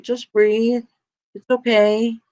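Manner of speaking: lightly voiced, high soothing friend; calm friend soothing. Mary calming the panicked speaker, lightly voiced, soothing. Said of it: "she's the calm in the storm".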